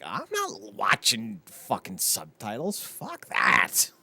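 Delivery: slow, drawn-out refusal rising at the end; mock stubborn refusal. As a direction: refuse it with hammed-up disdain. Acts out refusing subtitled film.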